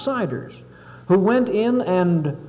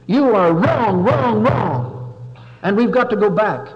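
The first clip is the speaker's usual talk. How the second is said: senator's speech; 4 semitones higher, 5 dB louder than usual. Retold or imitated. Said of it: "rose up in the Senate ... and said".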